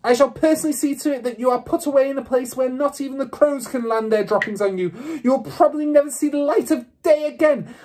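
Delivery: high, loud raging threats; maniacal rant. A narrator's reading. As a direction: rant with a boiling face. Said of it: "ranting like a maniac".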